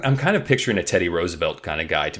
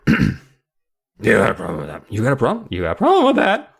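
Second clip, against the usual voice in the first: Larry's voice. Acted. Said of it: "No, that's Larry's voice".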